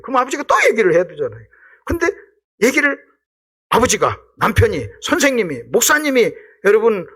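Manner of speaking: normal talking voice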